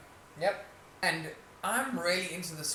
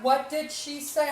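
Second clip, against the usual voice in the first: slow loud question; 10 semitones higher, 5 dB louder, slower than usual. Retold or imitated. Imitating Ashley asking.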